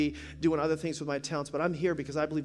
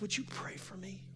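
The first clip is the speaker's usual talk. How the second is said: imitated tearful woman; 3 semitones higher, 11 dB quieter than usual. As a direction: ask softly as if through tears. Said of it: "She starts crying".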